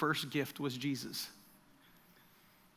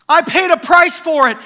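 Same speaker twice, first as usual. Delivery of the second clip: loud, high urgent claim; intense, not angry; 13 semitones higher, 19 dB louder than usual. Retold or imitated. Jesus telling Graham in the dream, loud fervent claim. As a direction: declare the price paid with fervour. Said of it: "Remember, not anger in the voice".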